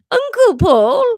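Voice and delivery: put-on very high, loud boy's cry; teenage Welsh boy. Younger son hears the bad news, high Welsh teen cry. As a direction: shriek it as a breaking-voiced teen. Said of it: "like, teenage Welsh".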